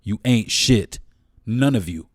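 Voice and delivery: ordinary talk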